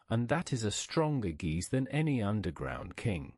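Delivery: usual speaking voice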